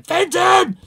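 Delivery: very high, very loud yell of the name; owner yelling. Retold or imitated. Owner chasing his dog round the park, loud high shout. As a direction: shout yourself hoarse after a runaway dog. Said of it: "chasing that poor dog around the park going".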